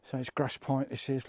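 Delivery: ordinary talk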